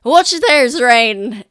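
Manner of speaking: loud, high hail across the roof; calling out. Acted. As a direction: call out to stop her. NPC calls out to character.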